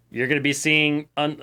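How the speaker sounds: ordinary talk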